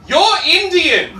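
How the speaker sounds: high, slow, loud retort; exasperated mock yell. Comic retorting to kid on school bus, high mock yell. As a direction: throw it back with drawn-out disbelief.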